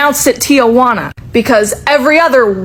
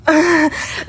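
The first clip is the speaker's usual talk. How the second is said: strained laugh; 4 semitones higher, 4 dB quieter than usual. Real, not acted. Laughing at herself.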